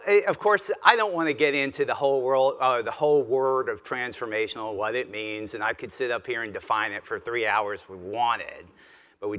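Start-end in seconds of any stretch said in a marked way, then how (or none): none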